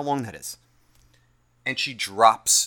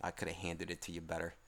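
muttered under breath; 6 semitones lower, 13 dB quieter than usual. Retold or imitated. Muttering through clenched teeth.

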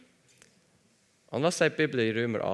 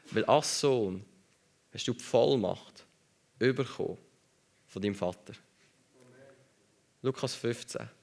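ordinary talk, nothing marked

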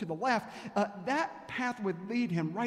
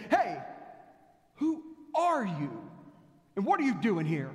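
guard shouting; 2 semitones higher, 4 dB louder than usual. Acted. Guard on the watchtower yelling at the rabbi, loud challenge. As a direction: shout down at a stranger in the dark. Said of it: "shouted down to them".

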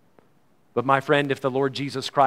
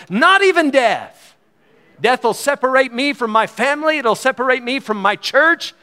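high and loud, rising to a ringing climax; soaring pulpit proclamation; preaching at full force; 5 semitones higher, 3 dB louder than usual. Acted. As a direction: preach it out with mounting fire. Opening Scripture, then building to a climax.

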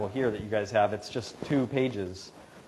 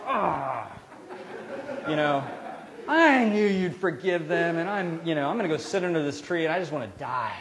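sulky Jonah; 5 semitones higher, 4 dB louder than usual. Acted. Jonah sulks at God.

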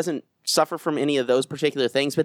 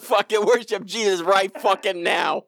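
hammed-up profane preacher, pitched up; mock preacher; 4 semitones higher than usual. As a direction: exhort the parish like a comic minister. Playing a foul-mouthed parish preacher.

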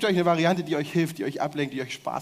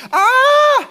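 piercing high scream, much louder; 15 dB louder than usual. Shown as a demonstration. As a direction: let out a shrill high scream for the crowd to copy. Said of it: "Ihr schreit". (lauter hoher Schrei zum Vormachen)

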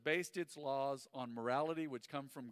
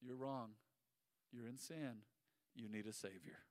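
quiet verdict; 4 semitones lower, 9 dB quieter than usual. Retold or imitated. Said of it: "the law just points out".

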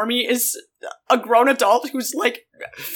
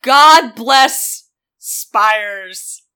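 very slow, louder benediction; hammed-up pious call; mock blessing; 8 dB louder, much slower than usual. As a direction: bless it like a preacher.

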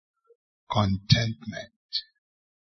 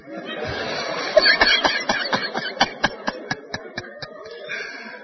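long, voiceless laugh; laughter. Real, not acted. Preacher amused by the retort he held back, laughing. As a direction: laugh it out at your own joke.

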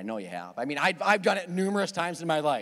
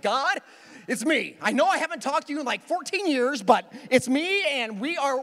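higher, louder frantic pleading to God; panicked prayer; 6 semitones higher, 3 dB louder than usual. Retold or imitated. Driver pleading with God as police lights appear, frantic. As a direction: bargain with God in a hurry. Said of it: "I went into religious mode".

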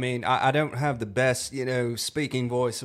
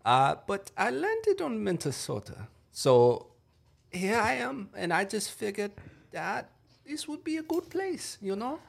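put-on German accent; 5 semitones higher than usual. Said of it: "let's do a German one".

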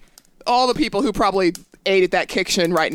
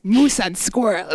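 put-on Russian accent; slower than usual. Boris-style villain catchphrase.

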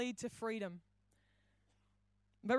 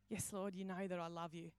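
quiet, lower humble answer; subdued reply; 5 semitones lower, 10 dB quieter than usual. Retold or imitated. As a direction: softly affirm it, as Peter to Jesus. Peter humbly answers Jesus.

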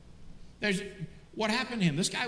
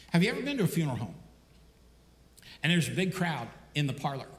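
ordinary talk

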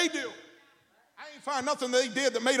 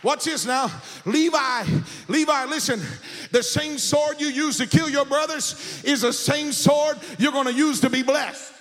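impassioned, raised pulpit shouting; full-force preaching; 4 semitones higher than usual. Real, not acted. Preacher tells congregation they are blessed, not cursed, full-force preaching.

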